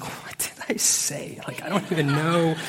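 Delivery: bewildered mutter with a laugh; baffled self-quote. Retold or imitated. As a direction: act lost in your own words. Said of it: "And other days I'm like".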